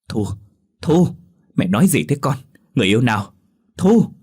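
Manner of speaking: high, loud frantic shout of her name; a mother shouting to rouse her daughter; frantic calling. A narrator's reading. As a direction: shake her awake with her name. Parents shaking and calling daughter.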